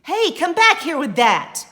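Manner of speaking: loud high call, chasing; loud angry shout from the hall; shouting after her. Acted. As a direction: yell to stop her running off. Ramon chases his sister.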